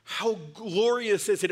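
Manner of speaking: ordinary delivery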